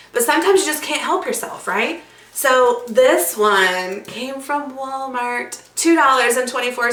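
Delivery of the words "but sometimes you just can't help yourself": ordinary talk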